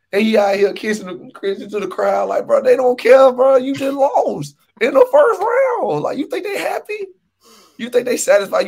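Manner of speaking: mock scolding. Lecturing player kissing the crowd.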